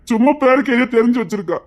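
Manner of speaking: ordinary talk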